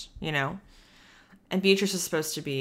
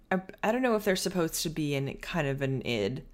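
normal talking voice

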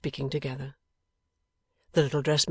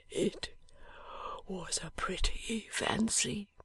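barely voiced, dying breath; a dying man's faint whisper; 6 semitones higher, 6 dB quieter, much slower than usual. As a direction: whisper it weakly from a sickbed.

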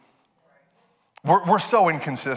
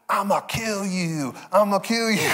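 toy fight voice; 5 semitones higher than usual. Retold or imitated. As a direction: play a little boy voicing a toy's threat. Voices son's fighting toys.

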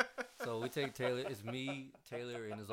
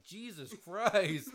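slow, higher, louder drawn-out groan; mock kid reaction; 7 semitones higher, 7 dB louder, much slower than usual. Imitating eight-year-old kids recoiling at the smell. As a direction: drag it out like a disgusted kid. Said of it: "they were like".

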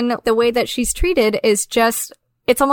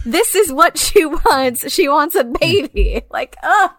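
higher, drawn-out exasperation; exasperated disgust; 5 semitones higher than usual. Real, not acted. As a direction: draw it out in dismay. Disgusted at the character's ending.

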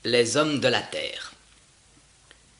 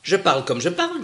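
curt reply; 6 semitones higher, 5 dB louder than usual. Acted. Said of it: "dit-elle".

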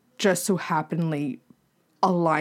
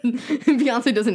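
laughing through the words, slow; 6 semitones higher, 4 dB louder than usual. Real, not acted. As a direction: say it while cracking up.

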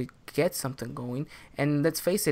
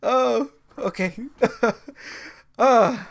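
stifled giggles; 7 semitones higher, 5 dB louder, much slower than usual. Real, not acted. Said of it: "let's get professional here".

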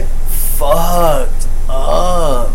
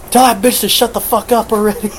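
angry demand; 8 semitones higher, 3 dB quieter than usual. Retold or imitated. Dad demanding she be silenced.

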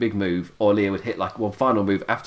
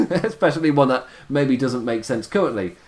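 usual speaking voice

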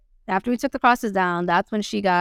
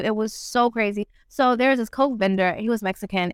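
usual speaking voice

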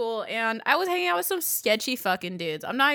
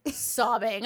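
ordinary talk

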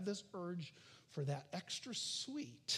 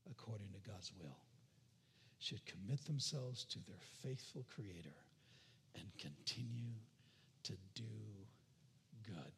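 hushed closing exhortation; 11 semitones lower, 8 dB quieter than usual. Real, not acted. Preacher closing the sermon to the congregation, hushed and low. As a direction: bring them down to a quiet reverent close.

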